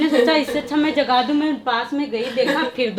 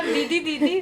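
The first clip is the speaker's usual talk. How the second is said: mock calling; 4 semitones higher, 3 dB quieter, slower than usual. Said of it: "शांति दीदी ने बोला".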